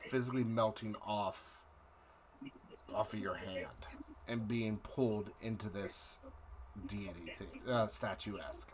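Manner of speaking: dramatic scene-setting narration; game master narration. Acted. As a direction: set the dark scene slowly.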